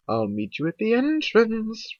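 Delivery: high, drawn-out call. A narrator's reading. Rarity to Twilight as she is led off, calling out. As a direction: sing it out slowly to someone leaving. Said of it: "Rarity called out".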